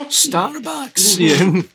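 high, loud mock-Scottish cry; Scottish accent. Shown as a demonstration. Echoing the co-host's Starbucks joke, hammed-up Scottish accent. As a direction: put on a big Scottish accent. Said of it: "Anytime I do a Scottish accent".

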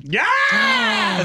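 high, whooping yeah; delighted whoop; excited cheer. Real, not acted. Cheering for the story.

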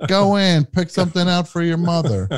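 high, pushy encouraging voice; high coaxing voice. Retold or imitated. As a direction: urge them in, sing-song and high. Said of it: "the world is saying".

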